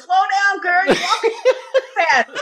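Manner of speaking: very high, slow, laughing imitation; high mock warning. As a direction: ham up his fussy concern. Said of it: "he goes".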